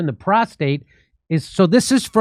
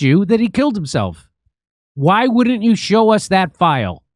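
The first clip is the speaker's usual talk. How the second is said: higher, insistent, drawn-out questioning; exasperated, raised-pitch demand; mock indignant challenge; 4 semitones higher than usual. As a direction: press the absent official for an answer. Mock challenge to absent official.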